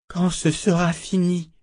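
high, sorrowful parting words; broken voice. A narrator's reading. Shota speaks without turning to him, broken-voiced. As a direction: say it with the voice breaking. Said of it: "dit-elle d'une voix brisée".

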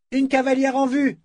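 high-pitched lookout call. A narrator's reading. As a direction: sing out a sighting to the post. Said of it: "lança Emmeline".

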